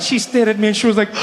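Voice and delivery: ordinary delivery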